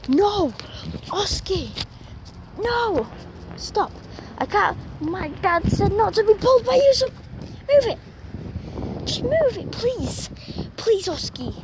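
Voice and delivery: high, loud, desperate pleading; being dragged by the dog, shouting high; panicked shouting. Real, not acted. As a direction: yell and beg as he pulls. Dog pulling her away.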